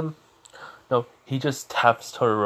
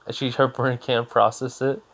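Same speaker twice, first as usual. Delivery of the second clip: ordinary talk, nothing marked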